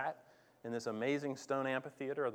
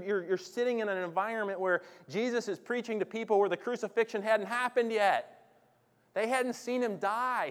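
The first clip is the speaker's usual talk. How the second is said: higher, louder, driving sermon voice; fiery preacher; impassioned preaching; 8 semitones higher, 8 dB louder than usual. Acted. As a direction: press the point home to the crowd. Preaching at full force.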